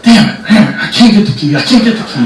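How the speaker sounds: rising, flustered swearing; frustrated cussing. Retold or imitated. Guards fumble with the locked gate, frustrated cussing. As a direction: curse in mounting frustration, imitating guards. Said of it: "you can hear them cussing".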